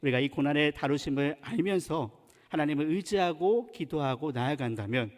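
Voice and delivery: ordinary talk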